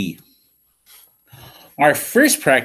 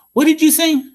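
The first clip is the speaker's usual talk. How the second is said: puzzled question; 12 semitones higher, 4 dB louder than usual. Acted. Asking the mumbler to repeat.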